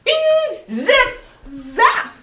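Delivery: high, loud goofy calls; silly clown. Pretend. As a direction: bounce out cartoon noises. Silly sound game, wild calls.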